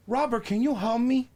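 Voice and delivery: high put-on plea; helpless cashier. Old cashier asks her colleague for help, helpless high voice.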